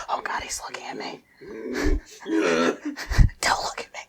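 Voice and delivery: slow panicked whisper; whisper, praying he won't notice; frightened whisper. Acted. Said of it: "They all whisper".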